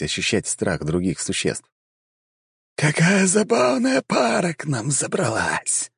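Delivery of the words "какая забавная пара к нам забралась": high, slow, otherworldly vampire drawl; a voice from beyond the grave; sepulchral voice. A narrator's reading. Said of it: "послышался его голос".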